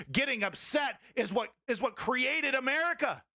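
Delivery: a fired-up call to arms; rallying shout. Real, not acted. Streamer preaching protest history to chat, shouting.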